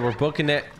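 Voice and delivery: normal talking voice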